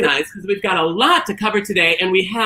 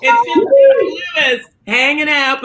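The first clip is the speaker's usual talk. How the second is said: high, loud cheery greeting; excited shout; 10 semitones higher, 3 dB louder than usual. Real, not acted. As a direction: yell hello to everyone.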